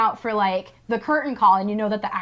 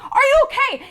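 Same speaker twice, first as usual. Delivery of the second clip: worried Seymour; 5 dB louder than usual. Retold or imitated. Seymour rescuing Audrey.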